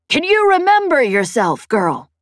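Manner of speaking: high, loud challenge falling away at the end; Peg challenging. Acted. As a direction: challenge the girl forcefully. Peg challenges the pilot over comm.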